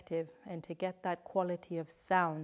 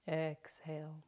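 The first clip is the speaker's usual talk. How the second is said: guided breathing cues. Shown as a demonstration. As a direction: lead the breath gently, leaving room to breathe. Counts breaths for the class.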